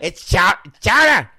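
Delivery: put-on loud, high Boston accent. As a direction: belt "chowder" the Boston way. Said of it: "can I correct you".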